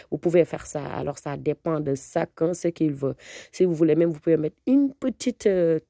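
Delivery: normal talking voice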